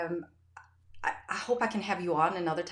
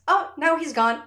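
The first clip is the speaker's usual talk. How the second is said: put-on much higher and louder, childlike; little girl's voice; 9 semitones higher, 8 dB louder than usual. Daughter reporting the imaginary man left, childlike. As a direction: chirp it like a small girl. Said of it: "And she goes".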